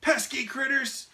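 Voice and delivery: high, loud annoyed yell; angry yell. A narrator's reading. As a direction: shout at the pests. Mike chasing pests.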